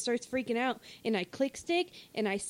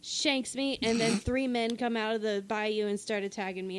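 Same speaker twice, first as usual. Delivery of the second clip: usual speaking voice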